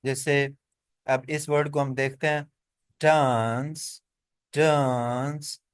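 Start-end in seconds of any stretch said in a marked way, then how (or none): 3.0-5.6 slow British डांस with a long open aah; डांस with a broad, drawn-out ah vowel; British pronunciation. Shown as a demonstration. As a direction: stretch डांस as daahns, rising. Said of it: "ये British इसको जब बोलेंगे".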